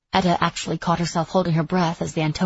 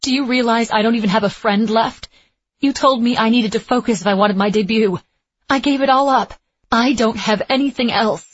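high, loud, hurt protest; upset outburst; 5 semitones higher, 6 dB louder than usual. A narrator's reading. Etta reproaches her instructor for all she gave up, upset and loud. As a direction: let the grievance pour out at full voice. Acted quarrel, student pours out grievance.

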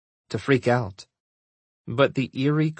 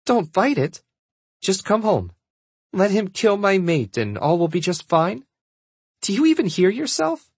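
high-pitched, incredulous retort; acted indignation, rising higher; indignant; 6 semitones higher than usual. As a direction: throw it back at mother in disbelief. Daughter argues with her mother.

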